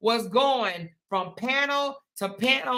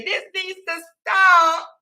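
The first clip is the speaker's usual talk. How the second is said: mock tearful begging; 4 semitones higher than usual. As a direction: imitate supporters wailing and begging. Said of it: "act like they wanna cry and beg".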